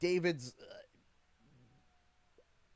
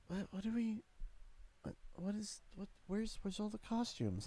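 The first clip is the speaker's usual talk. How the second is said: quiet bewildered mutter; 3 semitones higher, 10 dB quieter than usual. Retold or imitated. Bewildered inner thought at mall.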